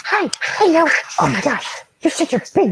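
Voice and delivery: high excited cooing. Real, not acted. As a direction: gush over the big puppy. Baby talk to dog.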